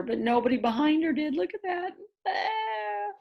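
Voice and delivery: high squeal of mock horror; high excited. Reader showing kids the roller coaster riders, squealing high.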